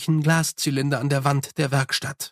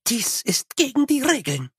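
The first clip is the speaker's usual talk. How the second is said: a raised, hissed protest; 9 semitones higher than usual. Acted. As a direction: hiss it between the teeth as the Lady. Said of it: "zischte sie". (gezischt, mit erhobener Stimme)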